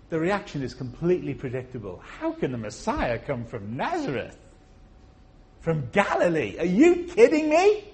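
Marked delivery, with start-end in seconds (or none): 2.2-7.8 incredulous scoffing. Acted. Incredulous crowd voiced in sermon.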